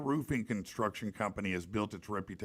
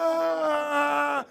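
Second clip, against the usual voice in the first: piercing high shriek; high-pitched yelp of pain; girly scream; 6 dB louder than usual. Retold or imitated. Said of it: "because I screamed like a girl".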